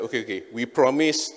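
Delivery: ordinary talk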